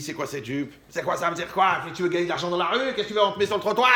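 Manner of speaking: harsh, loud scolding of his daughter, rising into a shout; angry father scolding. Retold or imitated. Father scolds daughter over a short skirt, angry shout. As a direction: berate her like an outraged father.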